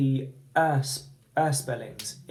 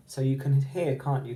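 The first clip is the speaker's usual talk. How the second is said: normal talking voice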